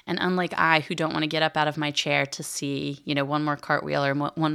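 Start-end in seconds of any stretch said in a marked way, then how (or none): none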